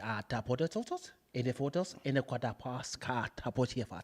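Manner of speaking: low, soft fake Spanish in an African-like accent; halting, hushed gibberish in an African-tinged accent; botched African-sounding accent. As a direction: mumble mock Spanish with a wandering African accent. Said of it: "it was African".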